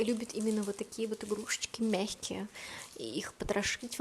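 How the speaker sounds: hushed aside; whispering. Real, not acted. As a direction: whisper it confidentially. Aside about the dog's toys.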